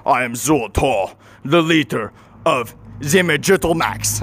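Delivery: slow, ponderous alien voice; alien leader. Acted. Alien introducing himself to his human foe, slow and grandiose. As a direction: announce yourself grandly, word by drawn-out word. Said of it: "the alien looks at me and goes".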